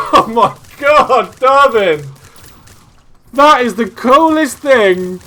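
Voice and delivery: loud, high, slow overjoyed shouting; thrilled shout. Real, not acted. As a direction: yell it out in total amazement. Thrilled by a friend's mod.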